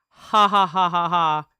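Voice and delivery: loud, evenly spaced ha-ha-ha; recited laugh. Acted. Streamer reading out their own message, loud recited laugh. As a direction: belt out a typed-out laugh syllable by syllable. Reads typed laughter aloud.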